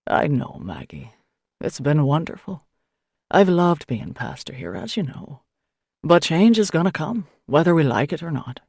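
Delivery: lightly voiced kindly priest, calming; a hint of a patient older priest; gentle priest. A narrator's reading. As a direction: soothe her, settle her down. Priest's voice in dialogue.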